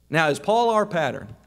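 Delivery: ordinary talk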